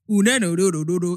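imitating an African accent, singsong rhythm. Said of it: "doing an African accent ... stupid rhythm when they're imitating us".

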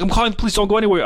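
high, huffy mock threat; threatening homeowner. Homeowner threatening to call the cops, high huffy voice. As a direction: puff up like a petty tyrant.

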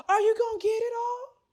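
high, light imitation of the aunt; patient asking the doctor, in a high airy voice; Cookie's voice. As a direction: ask it in her high airy voice. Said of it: "Cookie had a very light and airy voice".

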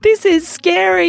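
high, loud scared yell. A narrator's reading. Benji yelling in fright on the fast slide. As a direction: shout your fear down the slide. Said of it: "yelled Benji".

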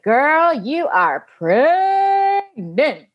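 high, slow, sing-song announcement; weird teasing voice. Retold or imitated. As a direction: drag it out like a playful reveal. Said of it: "Why are you doing this weird voice?".

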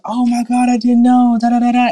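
put-on children finding a manga, high and loud; excited kid. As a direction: gasp it out like a delighted child. Kids discover manga.

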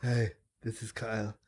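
deep Kyle voice. Retold or imitated. Imitating her co-host's deep intro voice, low and soft. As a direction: drop into a low laid-back intro. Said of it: "he has such a deep".

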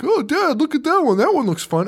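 put-on high-pitched eager little-girl voice; imitated young daughter. Host voicing a daughter calling to her dad, childlike. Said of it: "my daughter was like".

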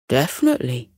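a hint of a steady father; father's voice. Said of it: "said Elliot's father".

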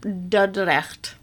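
Dordrecht said again slowly, guttural Dutch ch; patient native Dordrecht; Dutch city name. Shown as a demonstration. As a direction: model Dordrecht carefully for the listener. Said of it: "old city in the Netherlands".

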